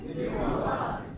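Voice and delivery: breathy whispered repeat; whispered echo of the quote. Acted. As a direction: breathe the words out.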